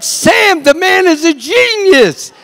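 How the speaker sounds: loud, high-pitched slow insistence; hammed-up exasperated yell; exasperated director. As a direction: shout it at a stubborn cameraman, drawing it out. Director argues with stubborn cameraman.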